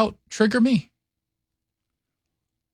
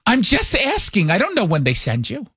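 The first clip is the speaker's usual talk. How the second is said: put-on mother's New York accent; 3 semitones higher than usual. Mother defends her questions to son, New York accent. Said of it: "talks like this all the time".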